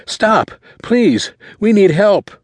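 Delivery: high-pitched, desperate plea; panicked boy flagging down a car; frantic scream. A narrator's reading. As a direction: cry out frantically for help. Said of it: "screamed out".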